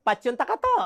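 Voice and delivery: gentle Ilonggo singsong warning; lightly voiced Ilonggo sweet threat; Ilonggo lilt. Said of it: "Binawarningan ka na".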